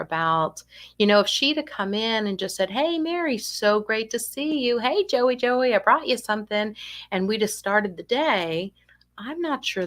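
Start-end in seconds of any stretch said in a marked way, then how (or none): 2.7-6.8 high, sing-song hello easing down; cheery greeting. Acted. Caregiver arriving to start the client's day, cheery greeting. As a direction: greet them brightly, pitched up.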